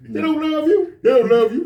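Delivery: hammed-up preachy rant; mock rant. Old teacher lecturing me on women, high and loud. Mister B ranting, repeated.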